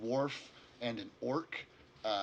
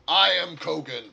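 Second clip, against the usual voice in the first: loud, higher announcement of his name; proud orc introduction; 5 semitones higher, 11 dB louder than usual. Acted. Orc leader naming himself to the party, proudly loud. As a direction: declare your name with pride.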